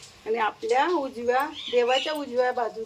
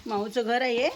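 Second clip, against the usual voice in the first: ordinary talk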